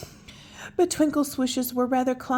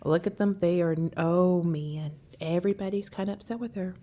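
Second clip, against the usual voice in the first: ordinary delivery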